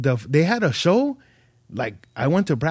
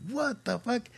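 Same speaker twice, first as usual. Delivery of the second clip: shocked; 9 semitones higher, 10 dB quieter than usual. Retold or imitated. Called up on stage suddenly.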